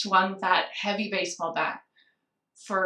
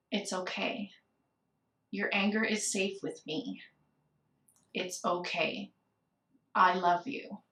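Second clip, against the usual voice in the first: much softer, slow, gentle comforting voice; tender reassurance; 3 semitones lower, 9 dB quieter than usual. Acted. God answering her anger, much softer and gently reassuring. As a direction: comfort her like a loving parent. God's reply, voiced in story.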